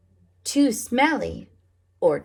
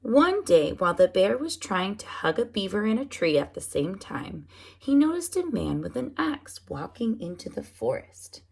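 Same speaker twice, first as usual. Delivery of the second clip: plain reading voice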